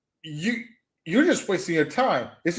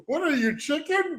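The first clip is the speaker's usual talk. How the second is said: high-pitched quoted taunt; Kel Mitchell; 10 semitones higher, 3 dB quieter than usual. Retold or imitated. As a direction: lightly act out the movie's chicken line. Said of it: "Kel Mitchell, right?".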